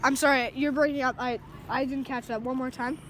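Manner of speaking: normal talking voice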